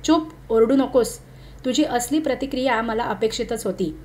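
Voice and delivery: ordinary delivery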